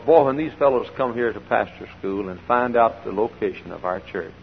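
ordinary delivery

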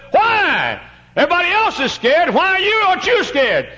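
high, loud acted disbelief; incredulous questioning. Passenger asks the calm little boy why, incredulous high shout. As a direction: demand an answer, voice shooting right up.

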